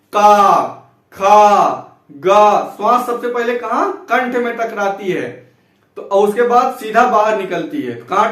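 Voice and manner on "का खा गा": का, खा, गा each said loud and crisp; clear syllable drill. Shown as a demonstration. Teacher drilling throat consonants: loud crisp syllables. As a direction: call out each letter for the class. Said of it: "का बोलिए".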